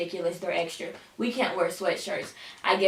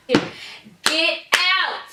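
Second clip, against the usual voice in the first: get out; 8 semitones higher, 5 dB louder, slower than usual. Real, not acted. Shooing someone out of room.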